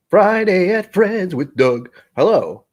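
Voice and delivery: slow, high, loud drawn-out show title; hammed-up host's greeting, stretched out; odd announcing voice. Streamer greets viewers to his show, odd announcing voice. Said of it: "I don't know why I said it like that".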